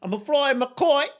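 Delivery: a Cockney Franklin bragging about flying his kite; mock Cockney brag; Cockney accent. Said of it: "Cockney accents that you gave him".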